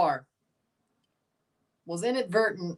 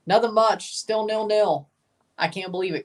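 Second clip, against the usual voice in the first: ordinary talk, nothing marked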